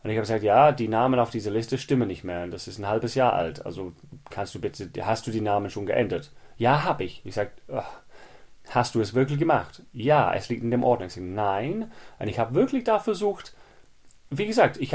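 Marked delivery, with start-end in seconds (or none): none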